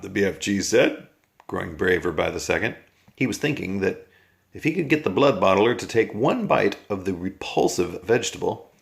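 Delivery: plain reading voice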